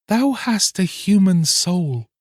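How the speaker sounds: high, slow, sorrowful mermaid; mermaid's voice. Acted. Mermaid answers the fisherman, high and slow. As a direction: gently refuse him, lifted high.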